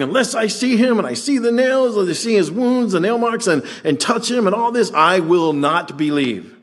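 high mock skeptical voice; lightly hammed-up Thomas insisting on proof; doubting Thomas. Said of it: "just imagine after saying".

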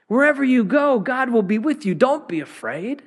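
high, ringing promise; fervent proclamation. Real, not acted. Pastor promising the congregation God's presence, high and fervent. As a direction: proclaim it with fire.

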